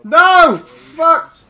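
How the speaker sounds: loud frustrated yell; shouting. Real, not acted. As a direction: yell out in dismay. Boss kill gone wrong.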